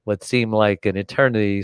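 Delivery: usual speaking voice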